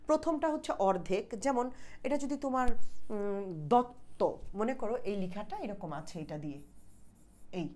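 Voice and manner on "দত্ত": দত্ত very slowly, held on a stopped first ত; split double consonant. Shown as a demonstration. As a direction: say দত্ত in two parts, dot, then to. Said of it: "আমি যে উচ্চারণ করছি".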